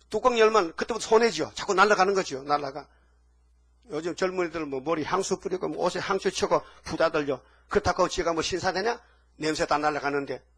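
ordinary delivery

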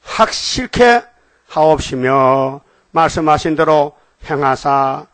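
slow, declamatory prayer reading with drawn-out words; solemn scripture reading. Acted. Preacher reads David's prayer to the congregation, solemn and slow. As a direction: read it out formally, stretching key words.

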